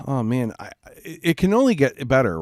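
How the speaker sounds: normal talking voice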